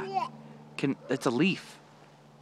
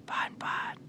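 whispering; 11 dB quieter than usual. Real, not acted. Whispered to toddler.

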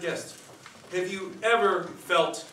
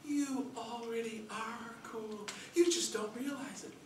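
tender mother; 6 semitones higher, 9 dB quieter, slower than usual. Acted. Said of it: "my mother".

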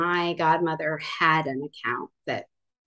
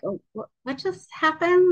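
much higher, bewildered retold reaction; baffled; 11 semitones higher than usual. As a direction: sound stunned and confused. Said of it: "I just was like".

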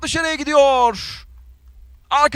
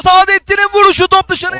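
excited commentator; 7 semitones higher, 8 dB louder than usual. Real, not acted. Near miss in live commentary.

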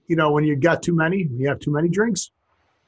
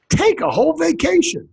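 high-pitched exclamation of surprise; surprised; 10 semitones higher than usual. Acted. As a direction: exclaim it high, like a startled Dane. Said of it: "when the Danish people are surprised".

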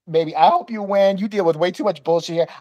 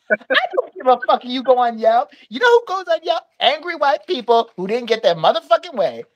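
imitated scornful tirade, pitched up; mock indignant rant; 5 semitones higher than usual. Clerk ranting about who goes on Yelp, mock scornful outrage. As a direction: play her scoffing at the threat, higher than your own voice.

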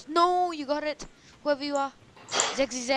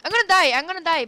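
higher, panicked cry of doom; 8 semitones higher than usual. Real, not acted. Player chased by Zack Zack cries out in panic. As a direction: sell the panic as he closes in.

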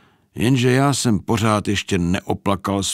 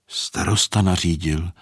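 plain reading voice

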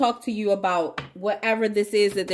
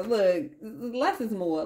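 toned down; slower than usual. Real, not acted. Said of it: "Let me stop being extra".

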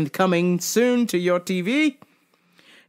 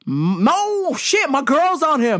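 ordinary delivery

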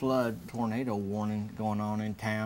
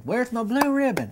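put-on much higher and louder, an old man demanding a prize; excited old man; 13 semitones higher, 9 dB louder than usual. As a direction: demand the ribbon high and loud like a giddy old man. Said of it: "and he said".

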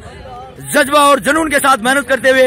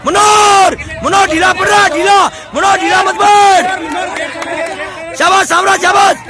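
coach's shouted cheering; 5 semitones higher than usual. Real, not acted. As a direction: keep yelling praise to drive the team on. Coach shouting at pullers to keep going. (ज़ोर से हौसला बढ़ाती coach की पुकार)

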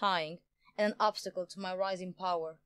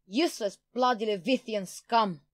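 angry outburst, louder; moderately raised, enraged villain voice; furious; 9 semitones higher, 4 dB louder than usual. A narrator's reading. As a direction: spit out the insult in fury. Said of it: "Jian shrieked in fury".

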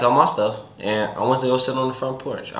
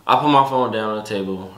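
normal talking voice

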